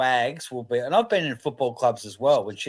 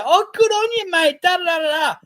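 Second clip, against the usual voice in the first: much higher, sing-song gushing voice; mock gushing wag; 4 dB louder than usual. Mimicking footy club wives gushing over a single player, high mock voice. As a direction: squeal it like a footy wife faking praise. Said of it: "like, the first to go".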